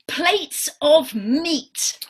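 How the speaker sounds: drawn-out clue; slow quiz clue. Shown as a demonstration. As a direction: stretch each word out for the children. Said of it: "let's try another one".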